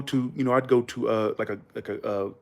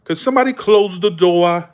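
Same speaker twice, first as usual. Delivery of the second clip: higher mock New England accent; dropped-R Northern call; Massachusetts accent; 4 semitones higher, 3 dB louder than usual. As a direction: imitate a shop worker's thick Massachusetts accent. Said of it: "What kind of fucking accent".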